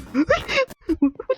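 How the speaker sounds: startled high shriek. Real, not acted. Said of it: "that fucking scared me".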